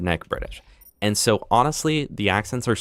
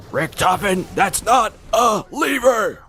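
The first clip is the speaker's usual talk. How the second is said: high, slow shouted character line; game character shout; 11 semitones higher, 3 dB louder, slower than usual. Pretend. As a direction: yell it at Richtofen in a put-on voice.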